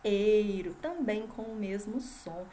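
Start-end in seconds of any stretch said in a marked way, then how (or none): 0.0-0.7 EIRO isolated, loud and held; stressed rhyme ending. Shown as a demonstration. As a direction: sound out "eiro" firmly for the class. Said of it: "que também terminam em". ("eiro" destacado, forte e esticado)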